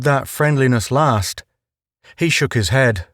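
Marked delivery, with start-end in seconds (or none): none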